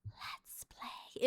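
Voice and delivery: voiceless creepy whisper of the game's voice. Retold or imitated. Streamer imitating the game voice whispering in her ears. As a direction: hiss it like the ghost in her ear. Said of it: "It said".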